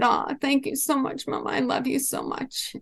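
a hint of a grateful young daughter; daughter's voice. Mother voices daughter's loving reply.

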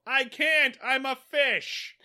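high, loud mock protest; imitated helpless shout; calling back. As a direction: call it up to the ship, drawn out at the end. Fish shouting back to navy.

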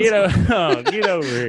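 high, laughing put-on coach. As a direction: call him over while cracking up.

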